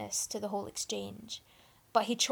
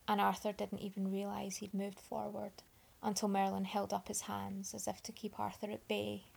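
usual speaking voice

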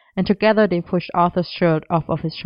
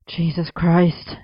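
slow, understated groan; much slower than usual. A narrator's reading. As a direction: draw the oath out in a mild groan. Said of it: "he groaned".

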